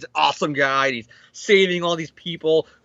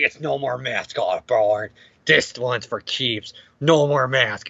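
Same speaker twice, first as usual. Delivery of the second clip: mock grim hero; 4 semitones lower, slower than usual. Retold showdown, hero unmasks foe.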